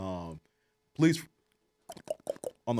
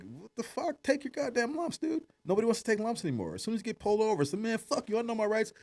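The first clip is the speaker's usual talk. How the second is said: rant; 11 semitones higher than usual. Real, not acted. Ranting about young offenders.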